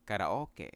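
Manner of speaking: "karaoke" as ka-ra-O-ke, pure vowels; tapped r in "karaoke", ending in keh; common mispronunciation. Shown as a demonstration. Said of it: "Some say".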